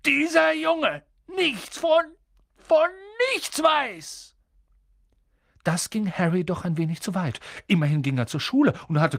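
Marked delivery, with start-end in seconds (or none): 0.1-4.3 slow, high-pitched incredulous fury; drawn-out outraged cry, building louder; growled outrage. A narrator's reading. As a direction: stretch each word in furious disbelief. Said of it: "knurrte er sie an".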